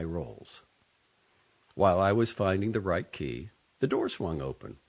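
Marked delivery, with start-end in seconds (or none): none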